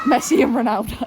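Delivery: laughing shout. Real, not acted.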